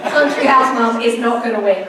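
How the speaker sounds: loud retold telling-off of mum; mock-exasperated, emphatic dismissal; exasperated scoffing. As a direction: scoff loudly, setting mum straight. Re-enacts scolding her mum.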